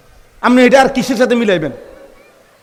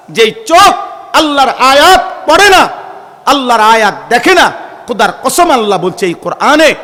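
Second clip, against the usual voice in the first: loud, rising rebuke from the pulpit; impassioned preaching; 3 semitones higher, 4 dB louder than usual. Real, not acted. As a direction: thunder the warning, stretching the key words. Preacher thundering a verse of rebuke. (আবেগী, জোরালো ওয়াজের সুর)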